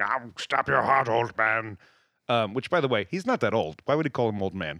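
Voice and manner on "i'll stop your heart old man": slow, lightly menacing demon line; a hint of a threatening demon; slow demon threat, barely voiced. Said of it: "this thing has grabbed him".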